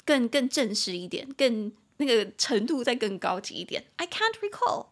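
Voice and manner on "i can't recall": high, poised "I can't recall"; formal reply. Shown as a demonstration. Answering a formal question with polish, high.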